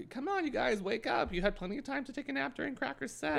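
high, fast teasing call to the crowd; teasing host. Retold or imitated. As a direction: lightly mimic her jab at the audience. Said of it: "I love the taking".

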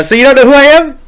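high, loud smug taunt. Retold or imitated. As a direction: rub it in his face. Retold student taunt.

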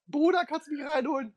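high, pleading mock guest voice; whiny guest. Friend outside the club begging to be let in, whiny. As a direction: whine it like a needy friend outside.

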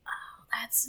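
breathless, slow awed whisper. Real, not acted. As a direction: hush it out in amazement. Awed whisper at planet news.

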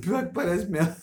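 laughing through the words. Real, not acted. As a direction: chuckle while saying it. Laughing at the chocolate Santa.

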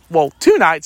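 ordinary talk, nothing marked

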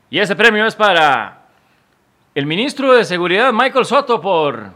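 loud, high build-up to the winner; award announcer. Pretend. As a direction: announce the winner with mock ceremony. Anuncia al ganador del premio.

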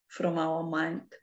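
normal talking voice

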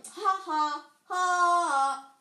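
high, loud mocking "ha ha"; gloating cackle. Poltergeist mocking Filch, gloating laugh. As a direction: crow it, stretching the last ha.

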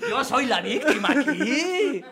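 high, slow, drawn-out mock whine; self-pitying victim act. Accused teacher playing the victim, high slow mock complaint. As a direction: wail it like a hammed-up victim.

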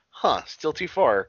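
slow, put-on puzzled voice; mock puzzled wrestler. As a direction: drag it out like a clueless guy reaching. Voicing wrestler reaching for belt.

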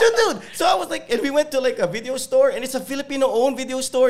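high and loud, laughing through the words; excited laughing. Real, not acted. Telling friends about his LA trip, high and laughing. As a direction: tell it bubbling over with glee.

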